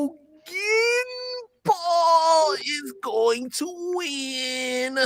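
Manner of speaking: very slow, strained groan; strained squeeze-out. Pretend. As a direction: drag each word out with great effort. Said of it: "squeak one out".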